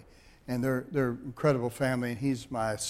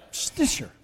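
SNITCHER burst out like a mock sneeze; sneeze-like surname; 13 semitones higher than usual. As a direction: say "Snitcher" as a sudden, breathy, high-pitched sneeze. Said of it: "that's what it sounds like, doesn't it".

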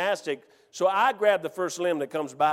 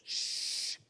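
long soft "shhh"; shush; 12 dB quieter than usual. Retold or imitated. Buddy silences the hunter, long shush. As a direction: hush him with a drawn-out shush.

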